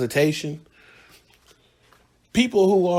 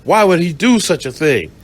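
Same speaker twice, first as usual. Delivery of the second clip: mock shocked cops; 3 semitones higher, 5 dB louder than usual. Police reacting to the girlfriend's story, hammed-up shock. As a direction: ham up the officers' horror. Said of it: "And so they like".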